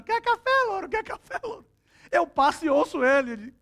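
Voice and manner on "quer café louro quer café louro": parrot squawk. Retold or imitated. Preacher imitating grandma's parrot calling out, high squawk. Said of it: "ele fica gritando".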